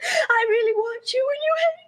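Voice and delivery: blurting it out through sobs, fast and breaking; sobbing. Acted. Carol sobbing out her feelings to her friend, crying hard. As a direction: wail it between sobs with a laugh caught in it. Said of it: "Please stop crying".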